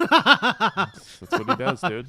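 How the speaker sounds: talking through laughter; laughing. Real, not acted. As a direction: laugh while you say it. Laughing reply.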